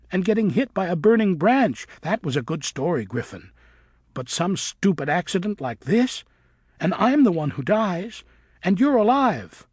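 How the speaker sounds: put-on girl bat shouting at her friend in anger. A narrator's reading. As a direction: hurl each line at him. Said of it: "She was shouting loudly now".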